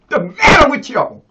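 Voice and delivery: loud, fired-up preaching to the congregation; full-force preaching. Real, not acted. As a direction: shout it from the pulpit.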